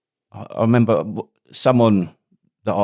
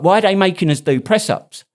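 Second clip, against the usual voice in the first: put-on whiny young lad; 7 semitones higher than usual. Mocking young recruits whining.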